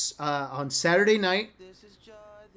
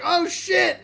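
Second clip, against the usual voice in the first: high-pitched delighted disbelief, imitating a friend; thrilled, imitated; 10 semitones higher than usual. Friend sees another girl coming to shake hands, imitated giddy thrill. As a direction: voice jumps way up, mimicking giddy surprise. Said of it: "He's, like, so happy".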